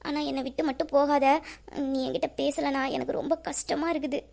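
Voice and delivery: barely voicing a fragile plea; a hushed plea to her friend not to leave; soft tearful plea. Pleading with best friend not to leave.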